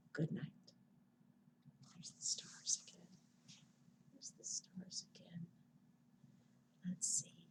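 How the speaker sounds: soft unvoiced whisper. Real, not acted. Librarian ending bedtime stories, whispering.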